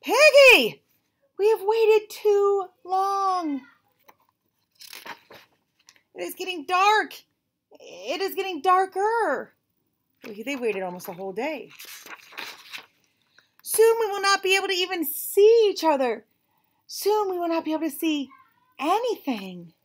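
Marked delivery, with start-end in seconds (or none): 0.0-9.5 high, loud, slow complaint; dramatic lament. Acted. Gerald complains to Piggy that it grows dark, high lament. 13.6-19.7 dramatic lament. Acted. Gerald complains to Piggy that it grows dark, high lament.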